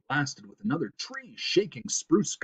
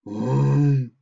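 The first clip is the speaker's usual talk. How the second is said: loud, drawn-out beast grunt; great grunt; 4 semitones higher, 7 dB louder than usual. A narrator's reading. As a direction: grunt loudly, as if coming closer. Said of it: "came the great grunting sound closer now".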